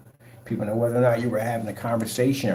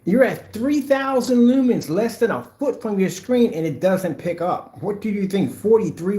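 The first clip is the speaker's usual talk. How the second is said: heated rebuttal; 10 semitones higher, 4 dB louder, slower than usual. Real, not acted. Firing back at gray-screen critics.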